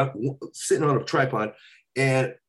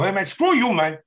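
high, loud mock Cuban gangster voice; Tony Montana impression; 6 semitones higher, 5 dB louder than usual. Retelling a phone prank on a friend, mock Cuban gangster voice. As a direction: snarl it like a Scarface gangster, raised and loud. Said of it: "I'm doing Tony Montana".

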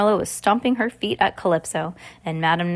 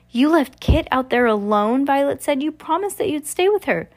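put-on higher-pitched, accusing young character; indignant girl; 6 semitones higher than usual. Violet accuses the others of leaving Kit, indignant.

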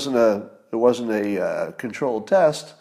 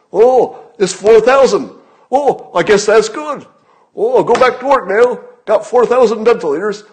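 much higher, louder mocking squeak; mocking a naive listener pleased by raw numbers; mock gullible citizen; 10 semitones higher, 10 dB louder than usual. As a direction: sarcastically parrot a dupe. Mocking gullible public reaction.

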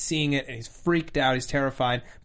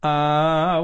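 ordinary talk, nothing marked

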